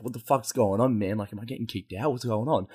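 soft, low what's going on; muttered confusion. Retold or imitated. Confused in the strip club, muttering softly. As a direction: mumble it under your breath. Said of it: "I was like".

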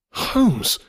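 high cry of amazement; astonished cry. A narrator's reading. Narrator greets his friend believed dead, astonished cry. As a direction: cry out in joyful disbelief. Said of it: "I cried".

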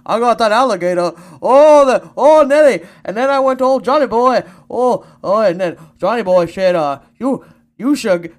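loud, high hammed-up Cajun accent. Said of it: "my Cajun accent".

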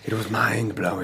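mock-sly schemer, kept understated; lightly put-on voice of a scheming character; Littlefinger voice. Shown as a demonstration. Co-host obliges a request for a character voice, lightly imitated. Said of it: "Say it in your Littlefinger voice".

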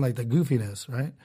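usual speaking voice

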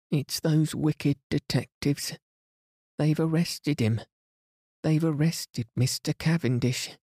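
high, agitated and tearful; frantic servant blurting bad news; distressed maid. A narrator's reading. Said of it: "She was crying and wringing her hands".

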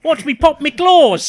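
high, loud, slow hammed-up Australian voice; Aussie Wolverine. Joining the joke as an over-the-top Australian Wolverine. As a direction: ham up a cartoon Australian Wolverine. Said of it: "he is Australian inexplicably in that".

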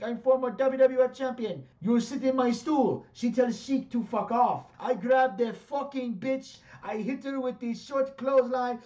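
high, loud furious Iranian accent impression; Iron Sheik accent. The Iron Sheik telling off the lady in his Iranian accent. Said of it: "But in his accent".

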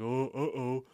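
dopey put-on moan; a daft, lightly voiced uh-oh; Louis. Imitating the damaged animatronic, dopey put-on voice. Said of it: "he's all like".

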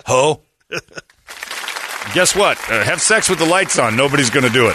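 loud high laughing heckle; mocking heckler. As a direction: heckle her loudly through a laugh.

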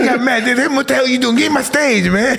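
high, shouting [name] voice with laughter; [name] impression. [name] shouting at [name] to get off stage, high. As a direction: yell like angry [name] reclaiming the stage. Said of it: "Great, great impression".

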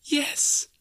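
put-on high, airy little-girl voice; small, delighted squeak; gleeful child. A narrator's reading. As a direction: answer high and full of glee. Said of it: "brimful of glee".

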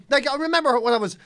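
normal talking voice

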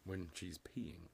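sly breathy whisper; whispered quip. Acted. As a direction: whisper it like a joke.

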